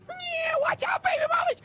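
shrieking chipmunk greeting; helium voice. Pretend. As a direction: squeak it out as high as you can. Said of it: "Oh my God, my voice".

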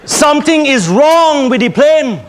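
ordinary delivery

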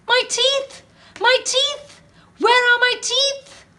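very high, loud panicked wail; panicked cry. Acted. Alan panics over lost teeth.

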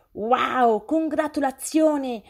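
high, slow, drawn-out gushing; gleeful slow teasing; enthusiastic. A narrator's reading. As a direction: tease her, all excited and winking. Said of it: "rise ammiccando entusiasta".

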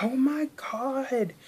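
high, slow, drawn-out gasp of amazement; amazed oh my god. Retold or imitated. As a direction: stretch it out like you can't believe the receipt. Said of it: "I was like".

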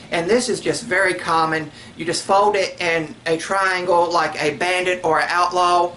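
usual speaking voice

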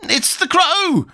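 very high, drawn-out cry of alarm; shrill alarm. A narrator's reading. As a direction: cry it out slowly and shrilly, without shouting. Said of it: "cried out in a shrill voice of alarm".

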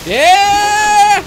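high, loud burst of joy; triumphant whoop. Real, not acted. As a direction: whoop at the hit. Lands a hit on the boss.

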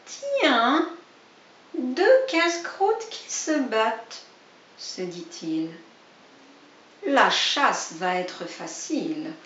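slow, sly voice; hungry fox eyeing two rabbits. Acted. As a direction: savour the easy meal. Hungry fox speaks to himself.

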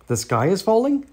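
lightly voiced turkey, pitch climbing on "falling"; gobbled question. A narrator's reading. Turkey Lurkey hears the alarm, startled questioning gobble. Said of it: "gobbled Turkey Lurkey".

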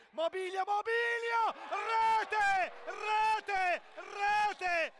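high, loud goal scream; breathless Italian goal commentary. Real, not acted.

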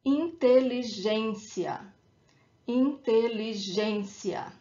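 inteligência as in-te-li-GÊN-cia; stress on accented ê. Shown as a demonstration. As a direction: model inteligência carefully, hitting GÊN. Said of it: "the emphasis is where the accent is".

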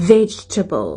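"vegetable" as VEJ-tuh-bul; "vegetable" stressed on the first syllable; English vegetable. Shown as a demonstration. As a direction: say "vegetable" with a real V and reduced middle. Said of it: "เอาฟันบนนะคะ แต่ริมฝีปากล่าง".

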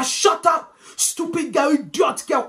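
mock telling-off from an outraged auntie; scolding auntie. Aunties arriving to silence the girl, loud scolding. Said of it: "That's how the aunties come now".

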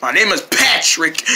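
ordinary delivery

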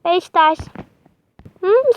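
usual speaking voice

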